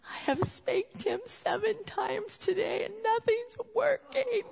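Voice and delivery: high, drawn-out wail, rising as it goes; mock sobbing. Sobbing mom tells husband about spanking, hammed-up high wail. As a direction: imitate a tearful wail at the end of your rope. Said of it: "And then sobbing".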